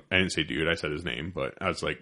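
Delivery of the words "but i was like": ordinary talk, nothing marked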